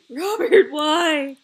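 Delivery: loud, high plaintive wail; mock anguish. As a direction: wail at the one to blame. Mock reproach to absent Robert.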